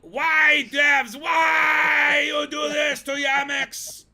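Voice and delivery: high, drawn-out shouted wail, mock Latvian accent; bad Latvian accent. Streamer pleading with devs for Yamix, bad Latvian accent shouted. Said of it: "That's the worst Latvian accent ever".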